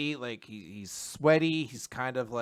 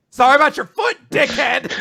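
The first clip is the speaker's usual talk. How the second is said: high, loud comic yell; hammed-up indignant shouting; mock angry outburst; 11 semitones higher, 11 dB louder than usual. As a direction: hurl the insult in a high shout. Acted snapping at him.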